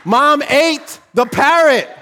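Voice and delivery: slow, high, loud exclamation; shouted punchline. Real, not acted. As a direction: hammer each word in comic disbelief. Shouted punchline over laughter.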